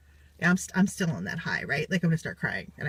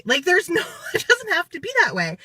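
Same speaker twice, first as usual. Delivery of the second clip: laughing; 9 semitones higher, 4 dB louder than usual. Real, not acted. Laughing through her point.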